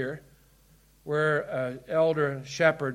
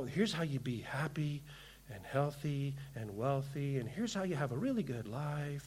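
whispered, much softer, lower, as if selling an easy message; hushed mock promise; 5 semitones lower, 14 dB quieter than usual. Preacher mocking a feel-good sermon to his church, hushed whisper. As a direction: breathe it like a tempting secret.

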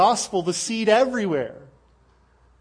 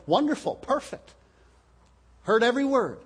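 lightly voiced praise, pitched up; approving listener; 6 semitones higher than usual. Dutch speaker praising the preacher's Dutch, mildly delighted. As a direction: play a pleased listener, a little higher.

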